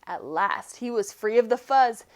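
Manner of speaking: usual speaking voice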